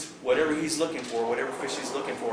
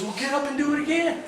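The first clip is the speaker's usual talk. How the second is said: urging friend; 14 semitones higher, 3 dB louder than usual. Retold or imitated. Ron urging him on.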